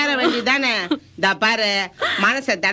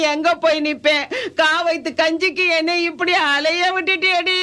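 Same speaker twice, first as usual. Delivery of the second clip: wailing lament. Acted. Grandmother mourns the granddaughter who left her alone, wailing. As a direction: wail to the dead, stretching the line endings. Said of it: "நீங்க இப்படி அழுதுட்டு இருந்தா".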